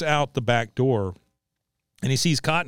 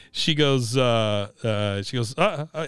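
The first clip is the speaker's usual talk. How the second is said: ordinary talk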